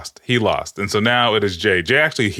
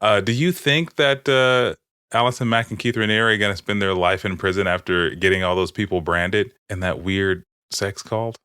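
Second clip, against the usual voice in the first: normal talking voice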